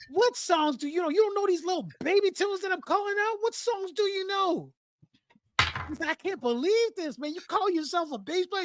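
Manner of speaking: high, strained berating voice held through; mock angry bandleader. Bandleader berating the bass player on stage, high mock outrage. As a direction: chew him out like a furious bandleader.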